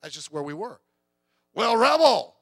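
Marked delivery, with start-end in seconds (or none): 1.5-2.3 loud mocking cry of the rebel type, much higher; mock rebel shout. Preacher mocking teen rebellion, with a loud sneering shout.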